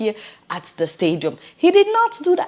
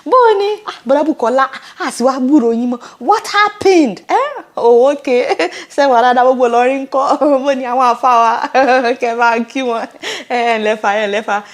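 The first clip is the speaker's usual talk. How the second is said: pretend phone call; 2 semitones higher, 7 dB louder than usual. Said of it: "let me call him".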